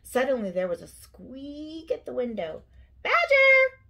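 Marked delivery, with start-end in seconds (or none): 3.0-3.8 very high, loud mouse cry. A narrator's reading. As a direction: call out urgently in a high squeal. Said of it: "cried Mouse".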